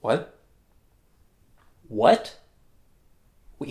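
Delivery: ordinary delivery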